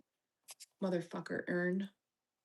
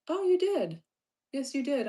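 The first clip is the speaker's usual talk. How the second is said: delighted praise; 6 semitones higher, 4 dB louder than usual. Real, not acted. Streamer praising viewer for filling the meter, delighted. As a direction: pitch leaps high, louder.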